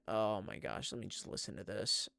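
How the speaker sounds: normal talking voice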